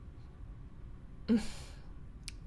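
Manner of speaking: long, weary breath out; heavy sigh. Acted. Weary sigh at the silent classmate. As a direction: sigh as if tired of waiting. Sighs before going on.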